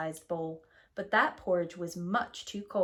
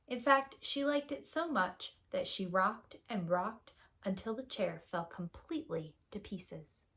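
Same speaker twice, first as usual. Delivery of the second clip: ordinary delivery, nothing marked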